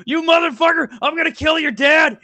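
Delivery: high, loud mock-furious threat; ranting heckler impression. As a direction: yell it like an outraged little tough guy. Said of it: "That's literally how he sounded".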